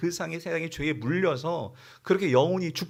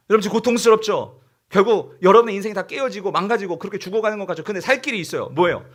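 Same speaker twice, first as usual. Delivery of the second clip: raised, urgent preaching cry; Moses proclaiming; 2 semitones higher, 6 dB louder than usual. Acted. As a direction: call out to a suffering crowd, urging them loudly. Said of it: "그리고 말해요". (백성에게 크게 외치며 호소)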